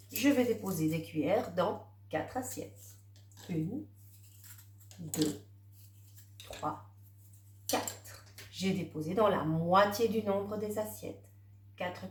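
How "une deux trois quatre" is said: counting papillons under the breath, softly. Acted. Teacher counting butterflies for the child, soft and murmured. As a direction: murmur the numbers one by one as you point.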